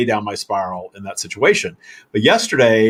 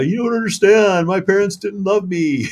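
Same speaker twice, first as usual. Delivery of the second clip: higher, slow whiny pity-me voice; mock self-pity; 8 semitones higher than usual. Mocking his old victim story, higher slow whine. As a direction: whine it like a hurt kid, half joking. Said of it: "I've sung that song before".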